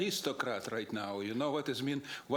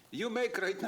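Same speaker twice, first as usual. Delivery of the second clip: ordinary talk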